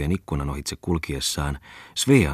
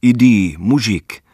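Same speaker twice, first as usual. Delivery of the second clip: Russian call; 5 semitones higher, 8 dB louder, much slower than usual. Retold or imitated. Russians calling their dogs.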